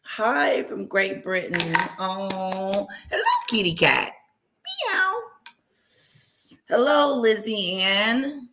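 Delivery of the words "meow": high, cutesy cat meow; a high-pitched kitten meow; playful meow. Pretend. Greeting a kitty cat viewer.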